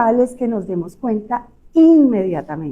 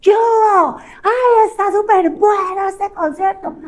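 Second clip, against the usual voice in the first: shouting at a concert; shouting over music; 9 semitones higher than usual. Shown as a demonstration. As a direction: yell to a friend over the band. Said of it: "pero tiene que gritar".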